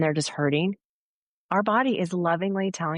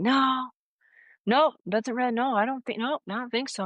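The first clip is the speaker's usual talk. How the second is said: imitating a client's higher-pitched, doubtful refusal; dismissive denial; 7 semitones higher than usual. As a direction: brush it off in a higher voice, fading at the end. Voices a sceptical client refusing.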